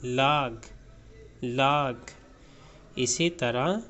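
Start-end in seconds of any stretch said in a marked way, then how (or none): 0.0-2.1 लाग said with a long open a, like "laag"; teacher modelling लाग with an open aa vowel; correct pronunciation. Shown as a demonstration. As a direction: say लाग with a broad a, never "log". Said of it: "लोग नहीं पढ़ेंगे, इसको पढ़ेंगे".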